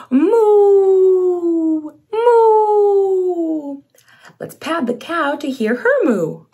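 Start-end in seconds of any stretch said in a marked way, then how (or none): 0.1-3.8 high, louder playful cow moo. Acted. Leading the child to moo together like a cow. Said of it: "Let's moo together".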